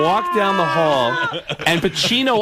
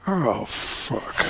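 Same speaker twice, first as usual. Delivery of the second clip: low muttered Al Pacino curse; a soft grumbled Pacino groan; Pacino impression; 5 semitones lower, 7 dB quieter than usual. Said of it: "Pacino looked and went".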